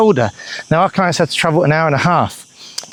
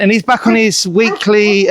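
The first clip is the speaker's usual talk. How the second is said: ordinary talk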